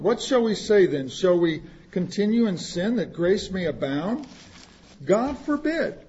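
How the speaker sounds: usual speaking voice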